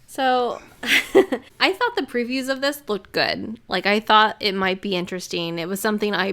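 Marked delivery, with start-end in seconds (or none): 0.8-1.6 breathy laugh. Real, not acted. Laughing at the movie.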